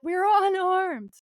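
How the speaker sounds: high, drawn-out plea; mock panic. Ed surrendering to the police, mock-panicked cry. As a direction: stretch it out high, pretending alarm.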